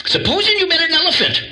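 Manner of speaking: high, quick put-on voice; Scarecrow. Scarecrow questioning the lion, high and quick. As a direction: pitch it way up and hurry the question. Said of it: "the scarecrow".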